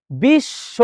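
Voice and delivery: বিশ্ব slowly as "bish-sho"; doubled sh, silent b. Shown as a demonstration. Teacher testing the silent ব ফলা, slow syllable-by-syllable বিশ্ব. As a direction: split বিশ্ব on a held "sh", no b. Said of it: "ব ফলার উচ্চারণ হচ্ছে না".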